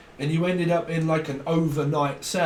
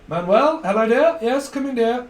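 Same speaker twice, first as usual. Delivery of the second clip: greeting voice; 6 semitones higher, 5 dB louder than usual. Acted. Acted greeting voice.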